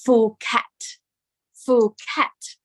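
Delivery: "Phuket" as "Foo-ket", with an F; English mispronunciation. Shown as a demonstration. Teacher showing how English speakers misread it, "Phuket" as "Foo-ket". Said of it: "especially native English speakers, say".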